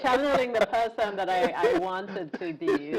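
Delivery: high, laughing through the words; giggling as she admits it. Real, not acted. Guest laughing at host's joke about her height.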